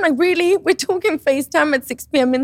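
can you believe this, a FaceTime?; incredulous outburst. Retold or imitated.

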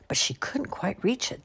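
ordinary delivery

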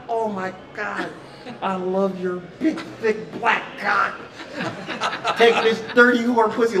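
slow, high, hammed-up porn-star dirty talk; mock porn moaning. Mocking the wife's loud dirty talk to the husband, hammed-up and slow. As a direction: drawl it out like a cheesy porn scene.

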